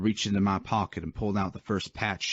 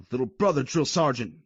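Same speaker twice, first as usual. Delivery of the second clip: through clenched teeth; clenched jaw; 5 semitones higher, 3 dB louder than usual. Acted. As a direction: grind it out, jaw tight. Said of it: "through a clenched jaw".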